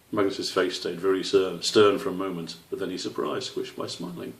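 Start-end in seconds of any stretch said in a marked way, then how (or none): none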